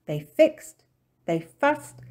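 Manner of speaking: plain reading voice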